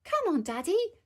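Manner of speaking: put-on high, eager child; impatient high-pitched little call. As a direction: urge Daddy along in a high voice. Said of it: "called Peppa".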